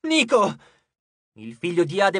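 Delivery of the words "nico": high, urgent call; short, raised shout to a wounded friend; alarmed cry. A narrator's reading. Jason cries out as Nico is hit.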